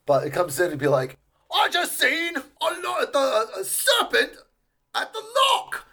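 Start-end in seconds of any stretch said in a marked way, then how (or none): 1.5-5.9 much higher, badly faked Scottish accent; a pub patron's wild story in poor Scottish. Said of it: "I can't really do a Scottish accent".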